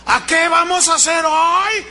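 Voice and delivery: high, loud hype-man call; rallying coach. Retold or imitated. Motivator firing up store employees, loud. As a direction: pump up the room like a sales coach.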